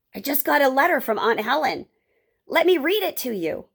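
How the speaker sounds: proud, eager announcement; excited grandfather. Acted. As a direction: eagerly offer to show off a new skill.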